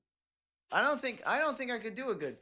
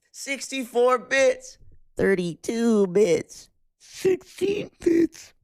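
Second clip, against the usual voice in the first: very slow, loud, drawn-out proclamation; hammed-up shouting; 7 semitones higher, 11 dB louder, much slower than usual. Streamer proclaiming bit counts to chat, hammed-up slow shout. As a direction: stretch each word out in a loud shout.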